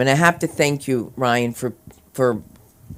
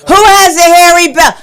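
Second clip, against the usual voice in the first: high, booming oblivious yell; failed whisper, shouted; 12 semitones higher, 10 dB louder than usual. Acted. Husband mishearing his wife's whisper, loud oblivious shout. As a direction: blare it out with no discretion.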